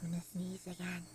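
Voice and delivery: unvoiced whisper before a sneeze; real whisper, a sneeze coming on; about to sneeze. Real, not acted. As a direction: whisper it, holding back a sneeze. Fighting off a sneeze.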